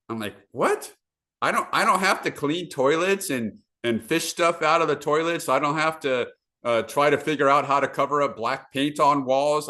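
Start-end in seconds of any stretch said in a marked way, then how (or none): 0.5-0.9 high-pitched, incredulous yelp; astonished. Retold or imitated. Young investor hearing a workshop tip, astonished. Acts out his astonished reaction. 1.4-9.7 higher-pitched, retold amazement; amazed disbelief. Landlord learning he can skip the toilets, mildly amazed.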